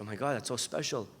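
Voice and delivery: very soft, low gratitude; hushed voice of someone touched; touched thanks. Retold or imitated. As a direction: say it quietly, deeply moved. Touched woman thanks neighbor in story.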